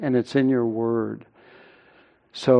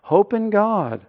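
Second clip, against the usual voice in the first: higher-pitched urging to oneself; self-exhortation; 6 semitones higher than usual. Acted. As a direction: encourage your own soul. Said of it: "to encourage himself in the Lord".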